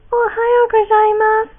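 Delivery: put-on high, loud sing-song anime girl. Shown as a demonstration. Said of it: "pretend like you're singing, but you talk".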